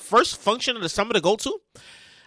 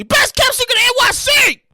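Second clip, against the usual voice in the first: shouted plug; 11 semitones higher than usual. Shown as a demonstration. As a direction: sell it like a hype man. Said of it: "Let me say that again".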